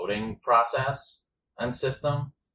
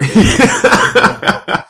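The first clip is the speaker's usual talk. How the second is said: laughing; 14 semitones higher, 15 dB louder, much slower than usual. Real, not acted. Laughing over violent fantasy.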